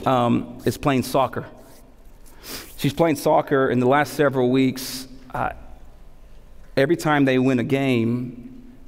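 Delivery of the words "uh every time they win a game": normal talking voice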